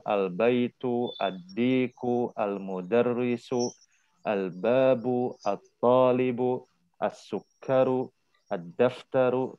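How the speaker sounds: slow model list, each word from "Al-baitu" to "ad-daftaru" closed on a clear u; every word ending in u. Shown as a demonstration. Teacher demonstrating the standard reading to the class, slow with u endings. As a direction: read slowly, voicing the final u on every noun. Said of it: "dijadikan u semuanya".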